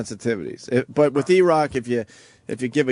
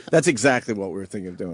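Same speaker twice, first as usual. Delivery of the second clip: ordinary talk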